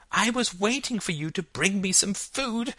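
put-on high-pitched little-girl protest; mild shout in a very high child voice; child's shout. A narrator's reading. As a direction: complain high, like an upset child. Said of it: "she shouted".

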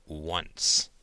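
low, ominous single word; sinister voice. A narrator's reading. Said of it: "She turned on a sinister voice".

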